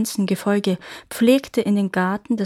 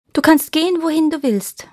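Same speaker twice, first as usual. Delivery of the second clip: higher-pitched, lightly acted girl; a hint of the daughter's voice, lifted; lightly voiced daughter; 8 semitones higher, 3 dB louder than usual. A narrator's reading. Lizard daughter reassures her mother.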